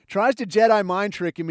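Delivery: ordinary talk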